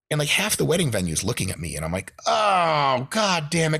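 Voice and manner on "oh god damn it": dismay. Retold or imitated. Noticing the stain, embarrassed.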